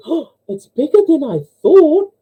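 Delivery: high-pitched, gasping mock nun voice; joke nun. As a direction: put on a squeaky amazed nun.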